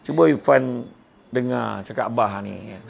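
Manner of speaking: gentle fatherly advice. Shown as a demonstration. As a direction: ease into it like a patient father. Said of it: "Cakap dengan Irfan".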